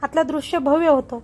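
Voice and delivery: ordinary delivery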